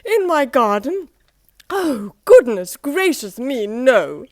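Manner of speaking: be scandalised at the very thought; Mister Fussy appalled at Mister Happy's request; prissy horror. Acted. Fussy horrified refusal.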